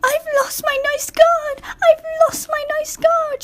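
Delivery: squeaky high sobbing carriage; sobbing coach. A narrator's reading. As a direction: wail like a little coach in distress. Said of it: "she sobbed".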